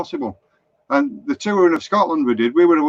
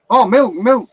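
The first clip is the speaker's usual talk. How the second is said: higher, louder American "oh, I get it"; imitated American; 7 semitones higher, 5 dB louder, slower than usual. Florida man finally understands the Lancashire customer, imitated American accent. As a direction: put on an American voice as the penny drops. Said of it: "I was in Florida".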